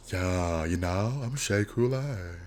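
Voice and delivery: slow, hushed drag queen imitation; Shea Coulee. Showing who Lux sounds like, hot-breath impression. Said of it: "Shea speaks like she has hot breath".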